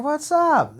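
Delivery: high mock-innocent greeting; exaggerated greeting. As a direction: ham up the cheery hello. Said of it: "So I'm like".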